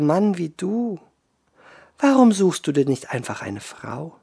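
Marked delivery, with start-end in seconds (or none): none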